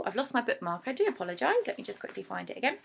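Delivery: ordinary delivery, nothing marked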